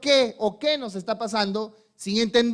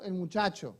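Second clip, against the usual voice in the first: ordinary talk, nothing marked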